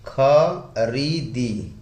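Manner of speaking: "खरीदी" broken into slow खा, री, दी; syllable by syllable. Shown as a demonstration. Teacher dictating the example verb to viewers, slowly syllable by syllable. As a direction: stretch each syllable of "खरीदी" separately.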